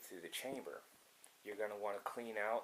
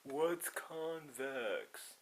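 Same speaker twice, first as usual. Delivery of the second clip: very slow, drawn-out, higher mock question; mock clueless questioner; 5 semitones higher, 3 dB louder, much slower than usual. Imitating people who don't know the word, mock puzzlement. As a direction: mimic puzzled people, stretching every word. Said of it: "people are".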